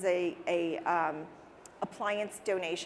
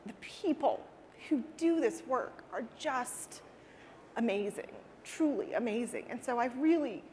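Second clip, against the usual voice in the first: tearful, emotional; 4 semitones higher than usual. Real, not acted. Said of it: "I'll cry thinking about it".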